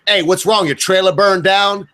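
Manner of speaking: mock heckling with drawn-out words; mock teasing coworker. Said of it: "I'd show up and be like".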